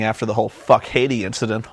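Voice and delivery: ordinary talk, nothing marked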